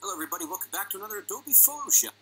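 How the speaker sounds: normal talking voice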